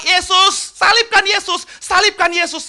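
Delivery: crowd yelling. Retold or imitated. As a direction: call it out like the mob. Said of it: "Orang-orang Yerusalem itu teriak". (teriakan massa yang ditirukan)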